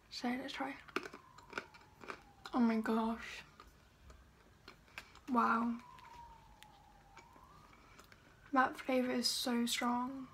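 talking between bites; chewing while talking. Real, not acted. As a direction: mouth partly full. Seen 2: Bites almond at -3; chewing mouth movements through early speech.

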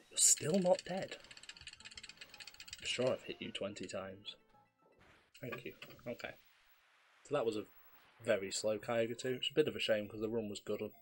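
ordinary talk

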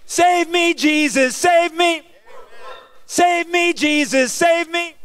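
high, loud desperate cry of save me; desperate pleading. Pretend. Preacher shows the congregation a desperate plea. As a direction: beg Jesus to save you, high and loud. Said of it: "How about".

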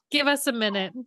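high-pitched talk-show parody, laughing; mock verklempt. Co-host joins the verklempt joke, high mock emotion. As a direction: echo the joke, playfully overcome. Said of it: "this is not coffee talk".

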